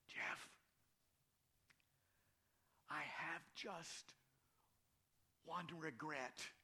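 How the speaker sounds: faint, slow, weak near-whisper. Retold or imitated. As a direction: speak like you can barely lift your head. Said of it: "in a weak voice".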